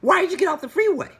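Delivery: high, loud, fast demand; exasperated yell. Retold or imitated. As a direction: shout it in disbelief. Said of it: "I'm like".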